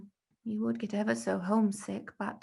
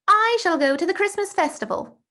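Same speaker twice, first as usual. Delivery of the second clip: put-on much higher, louder boastful boy; eager young boy Bertie, showing off; eager schoolboy; 11 semitones higher, 9 dB louder than usual. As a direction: brag about the holidays like an excited schoolboy.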